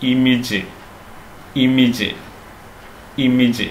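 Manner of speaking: ഇമേജ് as "IM-ij"; short "-ij" ending on ഇമേജ്; correct pronunciation. Shown as a demonstration. Teacher modelling for learners, ഇമേജ് as "IM-ij". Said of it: "അങ്ങനെയല്ല പറയേണ്ടത്".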